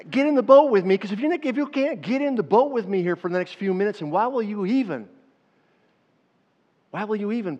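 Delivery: loud, high pleading; urgent appeal. Real, not acted. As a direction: beg them to come along. Pleading with congregation.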